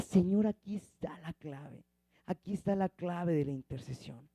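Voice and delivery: softly, as if confiding in God; soft, sinking retold prayer; hushed retold prayer. Retells own realisation addressed to God.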